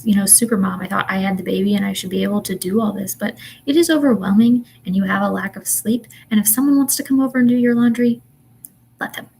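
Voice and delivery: usual speaking voice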